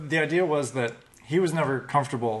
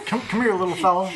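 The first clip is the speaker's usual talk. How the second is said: laughing; 6 semitones higher, 4 dB louder, faster than usual. Retold or imitated. Coaxing the monkey, laughing.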